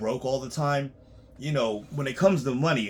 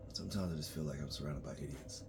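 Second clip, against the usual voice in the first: weary low murmur; 8 semitones lower, 12 dB quieter than usual. Real, not acted. Weary aside after sigh.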